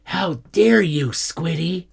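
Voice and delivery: high-pitched indignant snap; affronted, high scolding; snapping. A narrator's reading. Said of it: "she snapped".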